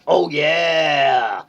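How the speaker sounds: high, loud hammed-up yell; over-the-top shouted cry; mock shout. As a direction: belt it out like a cheer. Acting the band's outburst.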